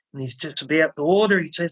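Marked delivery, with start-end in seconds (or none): none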